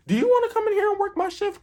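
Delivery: high, fast, mock-sweet sarcasm; fast sarcastic offer to hand over the shift; sarcastic retort. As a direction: toss it back high and quick, dripping sarcasm. Snapping back at rude customer.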